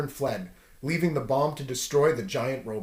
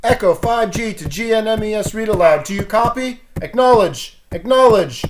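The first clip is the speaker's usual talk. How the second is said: high, loud radio hail; radio call; 7 semitones higher, 9 dB louder than usual. Acted. Storyteller hails listeners over the radio, high and loud. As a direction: call urgently over the comlink.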